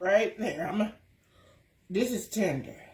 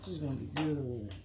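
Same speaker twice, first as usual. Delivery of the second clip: ordinary talk, nothing marked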